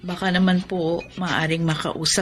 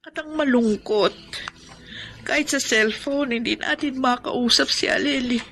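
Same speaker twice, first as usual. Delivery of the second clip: ordinary talk, nothing marked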